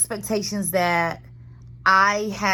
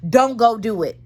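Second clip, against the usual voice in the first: ordinary talk, nothing marked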